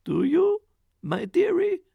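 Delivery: sweetly mock her husband; ogre's wife. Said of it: "said the ogre's wife".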